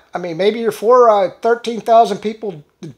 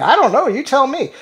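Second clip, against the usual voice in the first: normal talking voice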